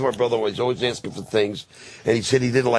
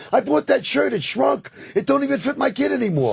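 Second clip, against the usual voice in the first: high, whiny complaining customer. Retold or imitated. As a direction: lift into a much higher whiny voice. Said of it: "'Cause people say to me".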